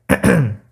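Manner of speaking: sharp cough to clear the throat. Real, not acted. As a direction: clear a choked throat loudly. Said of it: "sorry, I'm choking".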